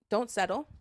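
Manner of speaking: usual speaking voice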